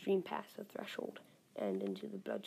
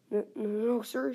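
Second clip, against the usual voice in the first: meek; 3 semitones higher, 8 dB louder than usual. Acted. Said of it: "says Lewis meekly".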